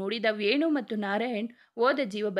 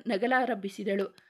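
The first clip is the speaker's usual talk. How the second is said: ordinary delivery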